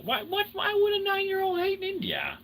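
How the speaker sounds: shoots way up into a high squeaky voice; incredulous squeak. Real, not acted. Asking chat why a kid would hate India, high squeak. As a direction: squeak it out in disbelief.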